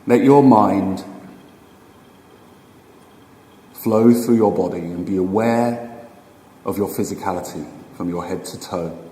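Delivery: slow, unhurried meditation guide with long pauses; guided meditation. Acted. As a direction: ease them into stillness, phrase by phrase, leaving room to breathe.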